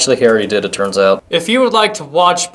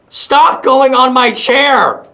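high, loud, drawn-out scolding; exasperated shout; 8 semitones higher, 5 dB louder, slower than usual. Real, not acted. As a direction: yell at the pet in exasperation. Scolds bird on chair.

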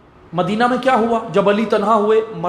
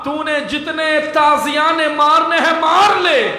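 high, impassioned cry held throughout; full-force lament preaching; 7 semitones higher than usual. Acted. Orator telling mourners of the whipping of Sajjad, full-force lament. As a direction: drive the tragedy home in ringing, sustained high tones.

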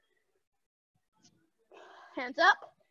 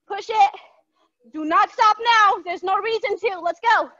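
loud coaching; 4 semitones higher, 6 dB louder than usual. Real, not acted. Coach pushing the final seconds.

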